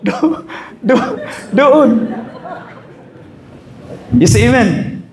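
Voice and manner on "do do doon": laughing through the word. Acted. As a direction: giggle it out while pointing. Laughing retelling the finger. (natatawang sinasabi)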